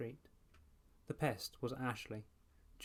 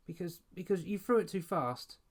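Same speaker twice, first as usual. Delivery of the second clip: put-on high, louder stumbling kid voice; flustered stammer; 7 semitones higher, 5 dB louder than usual. A narrator's reading. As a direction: trip over the first words, caught out. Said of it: "Ashley stammered".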